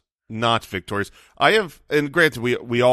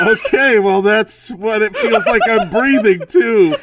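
muffled behind a mask; underwater; 9 semitones higher, 8 dB louder than usual. Real, not acted. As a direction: talk through a plastic fish mask. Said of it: "speaking underwater".

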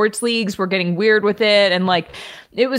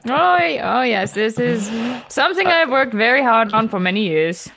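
put-on German accent, comic demo; 2 semitones higher than usual. Shown as a demonstration. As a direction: show off your hammy German accent. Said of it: "a fairly decent German accent".

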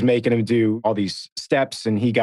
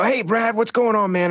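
high, bright mock greeting of himself; cheery greeting; 10 semitones higher than usual. [name] retells greeting Brad, hammed-up high cheery voice. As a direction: call out hey Brad with a high happy lilt. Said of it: "And I go".

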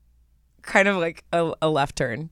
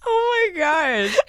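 higher, slow near-crying laugh; laughing oh my gosh; 10 semitones higher, slower than usual. Real, not acted. Still cracking up at the answer, a near-tearful laugh. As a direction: laugh it out until nearly crying.

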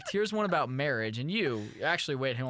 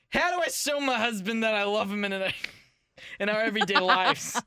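high, slightly louder mock reading in the mother's voice; hammed-up mother reading; 5 semitones higher, 4 dB louder than usual. Host as the mother reads a marriage post aloud, hammed up. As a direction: read the post as a hammy mom. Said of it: "It doesn't sound like you're taking it very seriously".

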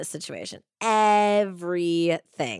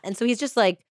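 ordinary delivery

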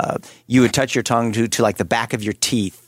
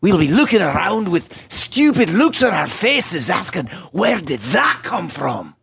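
mocking Scottish commander, slow and higher; Scottish warrior accent; 6 semitones higher, slower than usual. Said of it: "We need to hear the Scottish accent".

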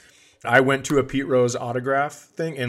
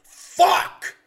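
sudden high frustrated curse; mock burst of frustration; angry swear; 5 dB louder than usual. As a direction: slam the table and swear. Pete Rose swears as race ends.

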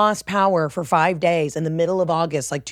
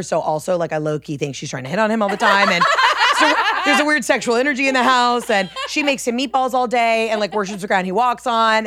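ordinary talk, nothing marked

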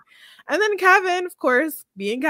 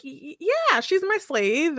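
nervous stammer; 7 semitones higher than usual. Retold or imitated. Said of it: "Kevin's like".

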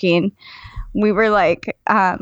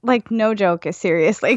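normal talking voice

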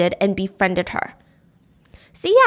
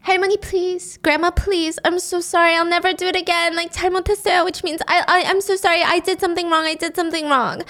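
mock child pleading; 6 semitones higher, faster than usual. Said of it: "And she's crying".

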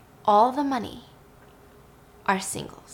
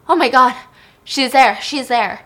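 loud, higher cry of disbelief; shocked gasp; 3 semitones higher, 8 dB louder than usual. Retold or imitated. Grindle seeing dead Dory in the garden, loud shocked cry. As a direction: blurt it out like seeing a ghost.

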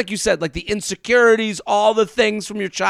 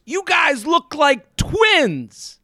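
stranger's remark; 7 semitones higher, much slower than usual. Retold or imitated. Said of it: "he comes up and he goes".

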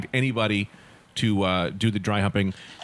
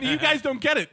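higher, insistent protest; exasperated protest; 14 semitones higher than usual. Retold or imitated. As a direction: insist they miss the joke, pitched up. S0 voices his own protest.

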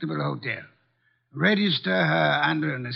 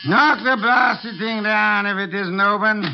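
raised, exasperated command; loud irritated order; 5 semitones higher, 5 dB louder than usual. Acted. Wolfe yelling to police at the door, irritated shout. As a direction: bark it over the noise at the door.

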